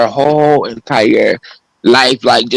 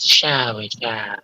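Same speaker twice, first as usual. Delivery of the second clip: soft, breathy ASMR murmur; 3 semitones lower, 8 dB quieter, much slower than usual. Shown as a demonstration. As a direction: drop to a soft ASMR hush. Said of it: "Shall I use my A S M R voice?".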